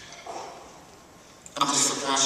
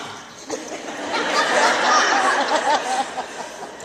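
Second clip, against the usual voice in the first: big high laugh; 12 semitones higher, 3 dB louder than usual. Real, not acted. Speaker laughs at child's granddad line, big high laugh.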